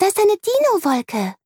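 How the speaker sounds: put-on very high, delighted little girl; girl spotting a dinosaur cloud, very high and excited; excited child. A narrator's reading. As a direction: point it out with glee. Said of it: "ruft Amelie und strahlt".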